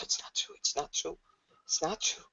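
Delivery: very soft repeated whisper; hushed, self-soothing murmur; whispering to herself. Acted. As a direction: whisper it under your breath in denial. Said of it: "she keeps whispering to herself".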